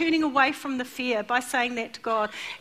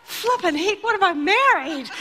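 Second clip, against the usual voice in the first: lightly voiced bemused husband, laughing; a hint of a dismayed new husband; husband reacting to his wife's spider panic, mock dismay; husband's exasperation; 4 semitones higher, 4 dB louder than usual. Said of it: "And he's like".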